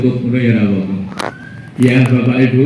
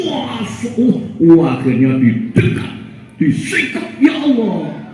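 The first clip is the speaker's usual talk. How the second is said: higher, heartfelt voice of the man; moved forgiver; 11 semitones higher than usual. Acted. (suara haru memaafkan dengan tulus)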